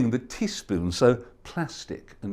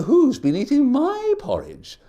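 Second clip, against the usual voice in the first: higher, lighter mother bear voice; Mama Bear; 10 semitones higher than usual. Acted. Mama Bear discovering her porridge, higher fussy. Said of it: "Mama Bear said".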